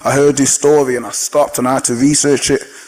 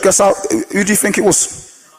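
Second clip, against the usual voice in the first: usual speaking voice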